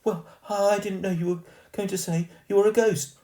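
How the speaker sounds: high, loud protest; girl protesting to the ghost; protesting posh girl. Acted. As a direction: object indignantly. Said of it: "protested the girl".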